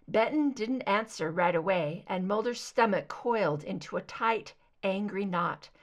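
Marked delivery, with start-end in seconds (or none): none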